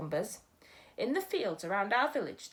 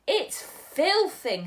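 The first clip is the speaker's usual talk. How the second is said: louder, slow, spluttered through a mouthful; spluttering; 8 semitones higher, 7 dB louder, much slower than usual. Acted. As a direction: spit the word out mid-chew. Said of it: "he spluttered".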